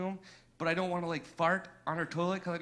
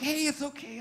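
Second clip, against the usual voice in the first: high-pitched, cooing reassurance; mock soothing; 10 semitones higher than usual. To freaked-out crying girlfriend, high mock-soothing coo. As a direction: pitch it high to comfort someone upset.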